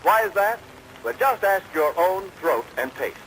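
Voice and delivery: ordinary delivery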